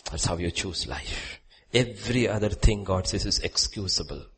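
ordinary delivery

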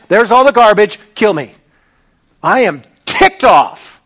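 loud, heated outburst at God; mock furious rant; angry Jonah. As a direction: vent like a fed-up prophet. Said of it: "He's like".

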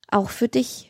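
plain reading voice

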